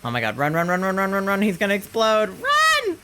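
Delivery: urgent babble climbing to a shriek; mounting panic. Real, not acted. Player fleeing an explosion, rising panic.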